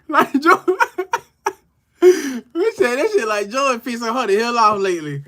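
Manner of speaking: high, laughing through the words. Real, not acted. Host laughing at a viewer's comment about George. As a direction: chuckle the words out.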